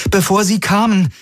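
hohe Frauenstimme; hoch, vorwurfsvoll; Kirai. A narrator's reading. Kirai spricht. (Kirai, hohe Stimme, vorwurfsvoll)

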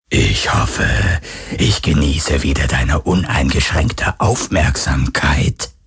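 low, very slow ghostly voice; menacing phantom. A narrator's reading. The phantom mocks Garland's bluff, low and slow. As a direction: let it drip with dark amusement.

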